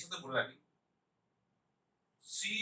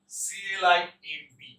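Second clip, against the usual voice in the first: usual speaking voice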